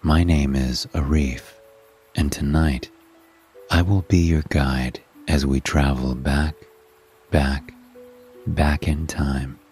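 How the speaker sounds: ordinary delivery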